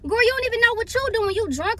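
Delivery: high, fast scolding; retold arguing. Passenger arguing with drunk friend, high indignant retort. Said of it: "like, trying to argue".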